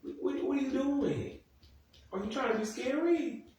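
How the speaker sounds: high-pitched mock bafflement; incredulous. Viewer imagining confronting a dad who talks creepy, incredulous. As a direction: question him in a high, disbelieving voice. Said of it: "I'd be like".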